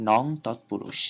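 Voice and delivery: নং তৎপুরুষ, with নং ending in a ng sound; ng demo. Shown as a demonstration. Teacher to students, modelling নং with ng. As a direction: demonstrate নং with a closing ng. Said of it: "উচ্চারণটা উম হসন্তের মতো হচ্ছে".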